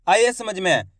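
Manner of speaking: ordinary delivery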